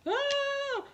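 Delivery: mock shriek. Kid freaked out by eyes.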